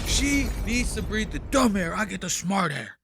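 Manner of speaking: a light send-up of the boss's boast; imitating Dave. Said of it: "I wish I could do Dave's accent".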